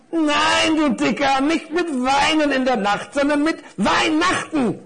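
high, cross little necktie; exasperated correction. Acted. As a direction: correct the fat one with high-pitched impatience. (empörte Krawatte, hoch und genervt)

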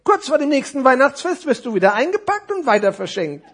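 high, loud character voice; animated high puppet voice; know-it-all necktie. Acted. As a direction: warn it as a storybook figure. Necktie keeps explaining.

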